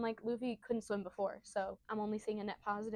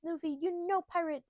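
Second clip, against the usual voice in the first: higher-pitched mock scolding; fretting mayor; 6 semitones higher than usual. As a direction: lament like a dismayed village elder. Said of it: "And the mayor's like".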